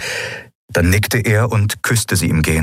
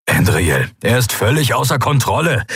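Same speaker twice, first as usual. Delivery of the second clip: agitated, pitch climbing into the outburst; raised voice; 5 semitones higher than usual. A narrator's reading. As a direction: voice rising more than intended. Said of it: "Telios wurde lauter, als er wahrscheinlich beabsichtigt hatte". (aufgebracht, Stimme hebt sich)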